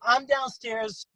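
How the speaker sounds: usual speaking voice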